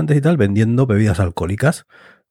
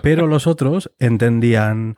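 ordinary talk

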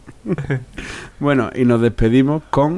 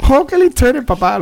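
put-on very high, louder awed kid; admiring child voice; 14 semitones higher, 3 dB louder than usual. Host voicing a child admiring his father, very high. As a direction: gush at dad like a little boy.

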